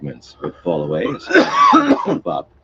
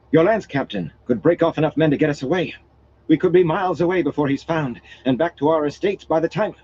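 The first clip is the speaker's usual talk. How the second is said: urgent; 11 semitones higher, 3 dB louder than usual. Acted. Noblewoman urges escape.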